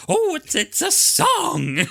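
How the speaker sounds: very high, loud, drawn-out guess; hammed-up excited discovery. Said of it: "and she's like".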